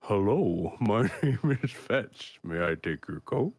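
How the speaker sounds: polite ogre line that cracks up midway; ogre butler, breaking into a laugh. Acted. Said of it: "As he turns to".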